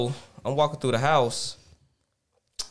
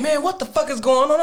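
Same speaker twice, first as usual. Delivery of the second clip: confused alarm; 10 semitones higher, 3 dB louder than usual. Retold or imitated. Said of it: "And I'm like".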